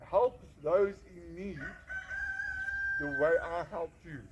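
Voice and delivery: slow, emphatic divine command; weighty, deliberate commission; solemn voice of God. Retold or imitated. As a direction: declare it slowly, word by word, like a calling. Retelling God's call to him.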